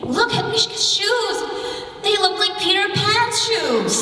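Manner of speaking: high-pitched schoolyard teasing; lightly acted high, taunting kid voice; mocking kid. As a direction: imitate a kid making fun of someone's shoes. Mocking kid pointing at her.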